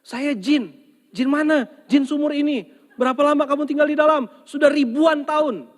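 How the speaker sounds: very high, loud squeaky spirit voice; squeaky jinn. Acted. Jinn explaining to the husband why he fled the well, very high and loud. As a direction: play the jinn as a comic squeaker.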